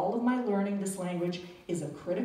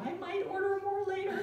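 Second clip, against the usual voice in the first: stiff phrasebook tourist; 12 semitones higher than usual. Retold or imitated. Phrasebook Italian farewell.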